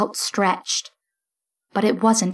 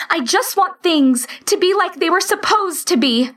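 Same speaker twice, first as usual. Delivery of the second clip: shouting; 9 semitones higher than usual. A narrator's reading. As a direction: shout it in anguish. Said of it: "she shouted".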